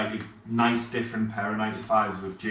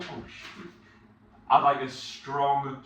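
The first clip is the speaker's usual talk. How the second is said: ordinary delivery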